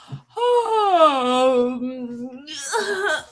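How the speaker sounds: long, open, deliberate yawn; big yawn. Real, not acted. Leading the chat in a yawn, big deliberate yawn. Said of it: "Here we go".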